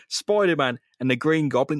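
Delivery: normal talking voice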